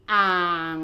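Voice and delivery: อ่าง held long and low; low-pitched, lengthened อ่าง. Shown as a demonstration. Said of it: "it in the low tone".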